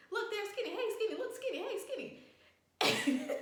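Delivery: very high, squeaky mock call; imitated excited calling. Tripping, spots Skinny and calls to him, imitated excited calling. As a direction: hail a friend in a hammed-up high voice. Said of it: "I don't know if I said this out loud".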